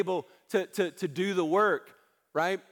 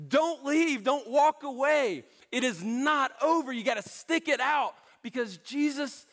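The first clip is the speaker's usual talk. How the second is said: high, emphatic preacher's plea; impassioned pulpit appeal; urgent exhortation; 6 semitones higher than usual. Real, not acted. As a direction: urge them hard to hang on. Preacher urges congregation not to give up.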